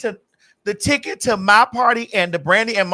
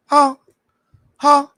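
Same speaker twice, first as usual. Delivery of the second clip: usual speaking voice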